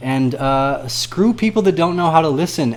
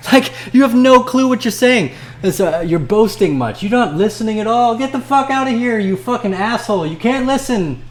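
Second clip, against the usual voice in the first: high, loud laughing rant; angry rant; 10 semitones higher, 4 dB louder than usual. Real, not acted. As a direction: yell it with a manic laugh. Ranting at commenters.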